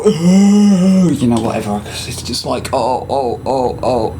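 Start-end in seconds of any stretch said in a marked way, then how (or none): 0.0-1.1 mock groan. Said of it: "and go".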